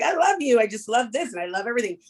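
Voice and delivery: mock-gushy "I love you", tossed off fast and high; careless gushing. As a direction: toss the word love around like candy. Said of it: "as if it's just like".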